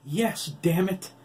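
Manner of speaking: loud, high, slow and desperate; frantic yell at the machine; cried out. Acted. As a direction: force it out in panic. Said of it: "Oliver cried".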